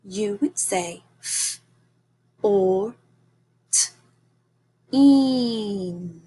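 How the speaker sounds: fourteen split into separate sounds f, or, t, een. Shown as a demonstration. Teacher modeling fourteen for the class, sound by sound. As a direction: tap out each sound of fourteen slowly. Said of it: "So if I said fourteen".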